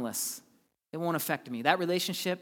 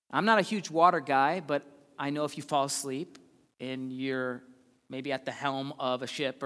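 ordinary delivery

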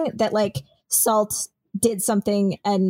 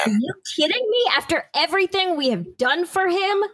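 much higher, indignant protest; indignant outburst; 9 semitones higher than usual. Acted. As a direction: rise up in outrage. Hera upset about leaving.

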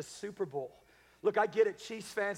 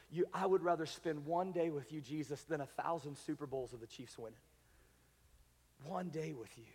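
1 hushed prayer; 3 semitones lower, 6 dB quieter than usual. Acted. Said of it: "tender prayer to Jesus".

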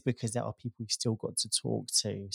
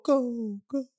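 fading repeated echo; imitating an echo; 13 semitones higher, slower than usual. Shown as a demonstration. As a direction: copy an echo dying away. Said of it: "I added that last bit on just to sort of give it a bit more effect".

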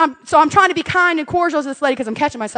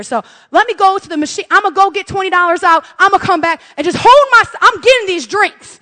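louder, higher, emphatic insistence; determined; 5 semitones higher, 5 dB louder than usual. Retold or imitated. Mom telling baristas she'll return, loudly determined.

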